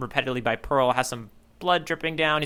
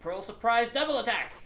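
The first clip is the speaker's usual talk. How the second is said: mock attack call; 8 semitones higher, 5 dB quieter than usual. Announcing a panel attack.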